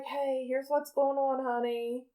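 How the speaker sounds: soft and slow, like waking someone kindly; mock-tender wake-up call; gentle parent voice. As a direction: hush it down as a parent breaking news.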